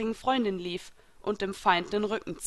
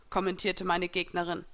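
plain reading voice

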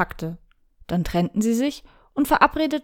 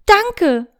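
high, loud burst of joy; joyful thanks; 9 semitones higher, 5 dB louder than usual. Acted. Shirayuki hugs Rio in joy, high joyful shout. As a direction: throw it out in sheer delight. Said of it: "vor Freude um den Hals".

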